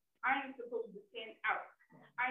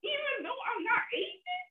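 very high, loud, slow crying plea; real upset, voice pitched right up; tearful protest; 10 dB louder than usual. Real, not acted. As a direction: cry out at the unfairness.

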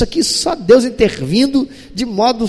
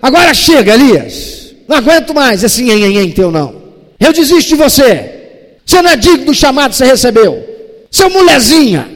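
loud, exasperated telling-off, higher and louder; harsh scolding; 5 semitones higher, 7 dB louder than usual. Retold or imitated. Fed-up friend scolding Elias, loud and harsh. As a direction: give up on him with an angry rebuke. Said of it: "dando-lhe uma bronca séria".

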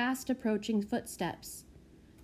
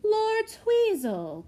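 high, slow, gushing welcome; gushing cry; 12 semitones higher, 5 dB louder, much slower than usual. Acted. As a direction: swoon at the lord's arrival. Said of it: "cried Missus Spindletrap".

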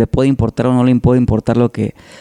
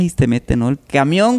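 usual speaking voice

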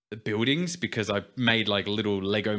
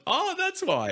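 much higher, a surprised "oh" of realisation; sudden realisation; slower than usual. Retold or imitated. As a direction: squeak it out as it finally clicks. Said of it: "I was like".

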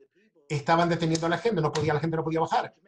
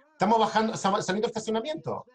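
mild yell up the stairwell; retold shout; 6 semitones higher than usual. Calling to an unseen guard through the door, mild yell. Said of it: "Le grité".

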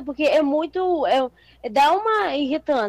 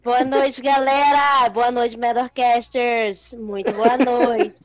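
slow drawn-out greeting; much slower than usual. Acted. Cumprimento arrastado e enfático.

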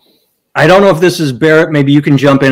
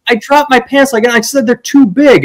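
high defensive protest; 11 semitones higher than usual. Retold or imitated. Retold defensive explanation.

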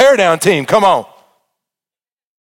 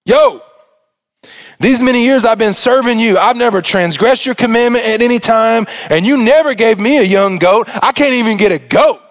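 put-on whiny older son; 4 semitones higher than usual. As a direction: complain like a jealous big brother, voice up high. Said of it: "I just like to think he said, "Yo".

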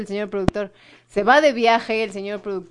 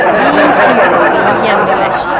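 loud, slow griping about the knee; loud complaint; 10 dB louder, slower than usual. Real, not acted. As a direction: drag out the curse in complaint.

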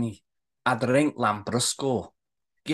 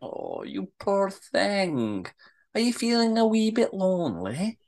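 slow, drawn-out sweet sympathy; syrupy pity, teasing out each word; sweetly; 3 semitones higher, slower than usual. Acted. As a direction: coo it sweetly, stretching the words. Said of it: "Kelly smiles sweetly at Wayne".

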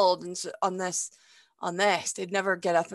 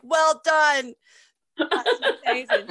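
high, loud fake congratulations; forced bright praise through a laugh; mock cheerful; 7 semitones higher, 7 dB louder, slower than usual. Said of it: "be like".

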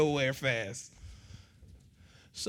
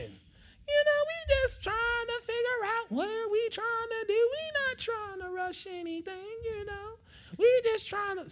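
put-on girly high voice. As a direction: whine the excuse like a daughter. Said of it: "daughter excuse".

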